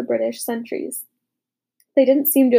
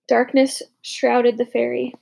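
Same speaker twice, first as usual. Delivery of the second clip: ordinary delivery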